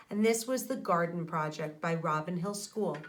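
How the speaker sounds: usual speaking voice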